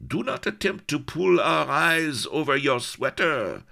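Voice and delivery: put-on high, loud French-accented bawl; raucous mock-French holler. A narrator's reading. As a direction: bawl it like a burly Breton pirate. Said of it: "bawled a burly Breton".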